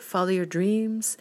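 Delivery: ordinary talk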